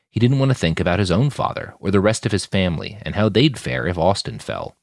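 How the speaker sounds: plain reading voice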